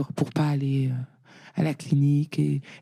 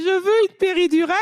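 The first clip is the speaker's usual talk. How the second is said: much higher and louder, pleading for the epidural; last-minute plea; 5 dB louder than usual. Retold or imitated. Mother in labour to the midwives, a high pleading cry. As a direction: beg for it at the last second, pitch shooting up.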